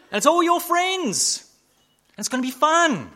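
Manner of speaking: hammed-up excitement about the night out; mock party excitement. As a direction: sell the party like an eager friend. Voicing friends' tempting party invitation.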